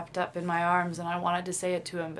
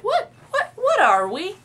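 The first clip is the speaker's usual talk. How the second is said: much higher, louder mock-casual squeak; 15 dB louder, slower than usual. Asking the chef what are we, mock-casual and much higher. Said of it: "really in a really casual way".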